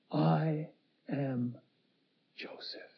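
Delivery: I am Joseph, dropping to a whisper, word by word; slow, fading hushed confession of identity; hushed reveal. Acted. Joseph reveals himself, words drawn out.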